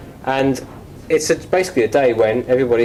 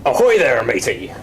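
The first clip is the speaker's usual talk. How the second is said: hearty pirate greeting, falling away at the end; play-acted pirate hail; pirate role-play; 2 semitones lower than usual. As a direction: answer the phone as a pirate. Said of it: "you can be a pirate".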